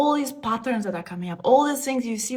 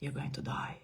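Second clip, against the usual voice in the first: toneless, much softer whisper; eerie whisper; 6 semitones lower, 13 dB quieter than usual. Retold or imitated. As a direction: breathe it like a ghostly warning. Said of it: "I heard this woman's voice saying".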